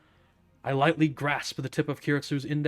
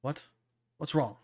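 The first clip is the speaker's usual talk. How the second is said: usual speaking voice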